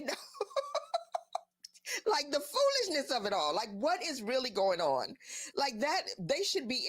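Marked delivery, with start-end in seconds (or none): 0.2-1.4 high, tittering laugh. Real, not acted. Teasing how they would know weed, laughing. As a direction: break up laughing on the last word.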